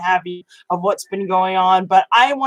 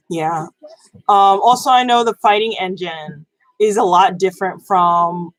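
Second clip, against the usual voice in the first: normal talking voice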